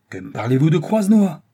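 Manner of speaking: father exclaiming angrily at his daughter; indignant cry. Acted. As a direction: cry out in disbelief. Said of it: "s'écria-t-il".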